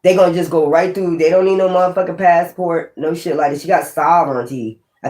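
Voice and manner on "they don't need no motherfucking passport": normal talking voice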